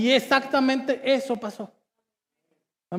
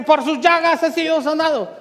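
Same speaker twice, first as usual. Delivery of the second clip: loud, raised re-enacted declaration; retold shout; 4 semitones higher, 5 dB louder than usual. Preacher retells shouting it in the car, loud and high. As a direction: imitate shouting it out in faith. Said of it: "llegó un momento en que grité".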